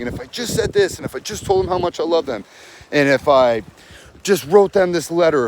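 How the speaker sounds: high, wistful mock plea; a lightly voiced, longing plea; pleading inner voice. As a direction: voice the desperate ex who hopes. Voicing clients' pleading inner thoughts.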